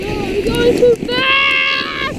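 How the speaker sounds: loud panicked cry. Real, not acted. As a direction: yell out losing control. Sled out of control.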